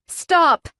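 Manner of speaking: high, panicked plea; desperate begging. A narrator's reading. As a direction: beg for it to stop. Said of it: "I beg".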